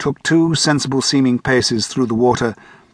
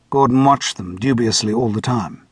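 plain reading voice

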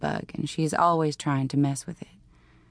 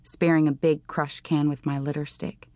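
plain reading voice

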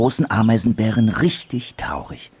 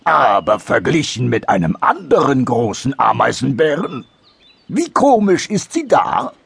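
raised voice, climbing higher into the question; challenging big anteater; 6 semitones higher, 4 dB louder than usual. Acted. Anteater challenging dormouse to compare noses, raised questioning voice. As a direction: press the point, rising as you go.

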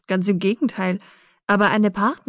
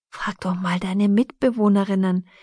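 ordinary delivery, nothing marked

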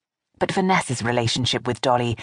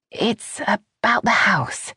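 slow, hesitant opening; a nervous daughter-in-law hesitating to raise the subject; 8 semitones higher, slower than usual. A narrator's reading. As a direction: ease in slowly, unsure of yourself. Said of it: "Vanessa began hesitantly".

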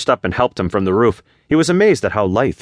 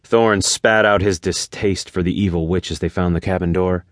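ordinary delivery, nothing marked

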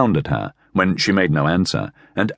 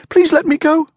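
very high, urgent plea; frightened pleading; 4 dB louder than usual. A narrator's reading. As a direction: beg high to be let go. Frightened woman begs to be released.

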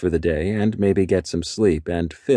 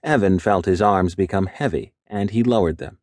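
plain reading voice

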